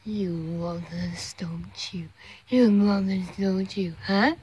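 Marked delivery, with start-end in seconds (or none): 0.1-2.1 soft unvoiced whisper to a pet. Real, not acted. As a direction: breathe it, barely voiced.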